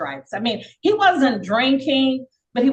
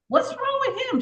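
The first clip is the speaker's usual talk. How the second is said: much higher, alarmed question; startled question. Retold or imitated. New inmate asks her friend about the man, shocked and high-pitched. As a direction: ask it in shocked disbelief. Sees man hitting head.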